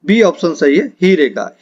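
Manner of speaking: usual speaking voice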